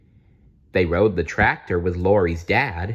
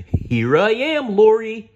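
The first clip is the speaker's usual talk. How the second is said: happy Barney; 14 semitones higher, 6 dB louder than usual. Acted. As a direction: greet the child with big joy. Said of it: "said a deep, happy voice".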